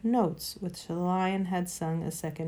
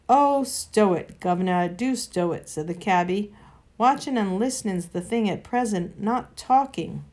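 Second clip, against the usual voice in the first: gruff cabby; 4 dB louder than usual. Acted. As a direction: tell the old gent to shut it. Cabby voiced, telling him off.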